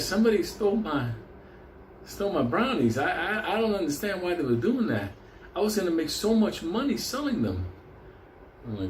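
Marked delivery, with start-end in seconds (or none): 0.0-7.6 higher, whiny hippie voice, lightly done; Mister Burroughs complaining. Retold or imitated. Hippie tells the teller about stolen brownies, higher complaining voice. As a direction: play the wronged hippie baker, pitched up. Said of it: "he said".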